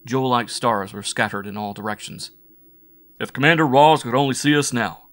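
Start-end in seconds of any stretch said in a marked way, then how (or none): 3.2-5.1 loud, higher-pitched glee; boyish delight. A narrator's reading. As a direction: crow it loudly with boyish delight. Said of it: "boyishly delighted by a sense of freedom".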